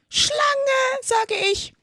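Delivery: very high, loud insistence; shrieked accusation from an angry bird; screeching pigeon. A narrator's reading. Pigeon repeating its accusation at Alice, screeching. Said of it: "wiederholte die Taube".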